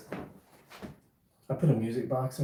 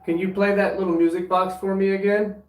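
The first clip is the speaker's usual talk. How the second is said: loud, raised voice calling upward; 9 semitones higher, 10 dB louder than usual. Real, not acted. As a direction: call out to the spirits. Calling out to spirits.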